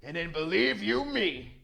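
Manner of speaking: loud, high, sneering bully boss; loud mocking brute; brutish bully boss. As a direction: boom it out like a swaggering thug.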